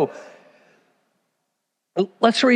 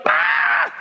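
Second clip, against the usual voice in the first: high breathless gasp of joy; sudden high unvoiced gasp of delight; excited gasp. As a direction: gasp like a girl who just heard good news. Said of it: "and she's like".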